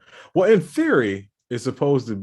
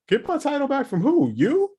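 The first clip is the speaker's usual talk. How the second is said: much higher, incredulous retort; mock disbelief; 5 dB louder than usual. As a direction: scoff at the ad like it's absurd. Mocking the title loan ad.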